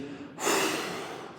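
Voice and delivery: long breathy sigh of relief; boy sighing in relief; relieved sigh. A narrator's reading. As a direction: blow out your cheeks. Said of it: "blowing out his cheeks in relief".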